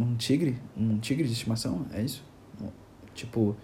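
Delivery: low, hushed disbelief, slowing down; baffled listener. Acted. Listener questioning the pet tiger idea, quietly baffled. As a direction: question it under your breath, halting and incredulous. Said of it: "Você vai falar assim".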